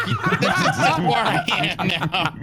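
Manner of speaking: mock cat voice. Cat on dashboard quote.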